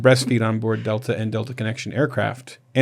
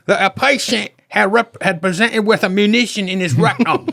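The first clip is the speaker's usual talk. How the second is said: weak, higher British accent attempt; 7 semitones higher than usual. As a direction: try a British accent and miss. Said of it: "I can't do British accent".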